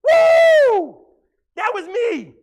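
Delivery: loud, high whoop of joy; real whoop. Real, not acted. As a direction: cheer like you just won. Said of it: "and I'm going".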